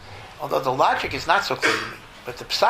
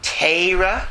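Teira, the Torah vowel as an ay, pitched higher, rising; a Litvak Teira with an ay sound, lifted and louder; 6 semitones higher, 5 dB louder than usual. Shown as a demonstration. Rabbi showing the Lithuanian Yiddish Teira, higher, rising. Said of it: "if you're a Litvak".

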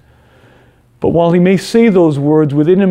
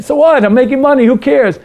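much higher and louder, fed up; defiant outburst; 8 semitones higher, 4 dB louder than usual. Acted. Selfish man loudly tells his conscience to leave him alone. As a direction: shout it off, defensive and annoyed. Self dismissive.

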